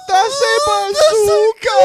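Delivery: loud, high, slow mock wail; crying. Suspect caught with a weapon, loud mock crying. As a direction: bawl it out at full volume for laughs. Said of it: "He just started crying".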